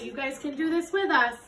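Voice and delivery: ordinary delivery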